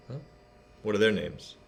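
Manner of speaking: plain reading voice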